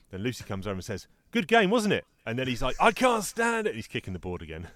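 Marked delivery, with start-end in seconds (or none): 1.3-2.0 high, loud mock-friendly voice; chirpy taunt. Lucy coming over after winning the game, chirpily taunting. 2.8-3.7 high-pitched mock tantrum cry; exasperated outburst. Charlie Brown snapping when reminded of his loss, exasperated. Sore loser explodes once more.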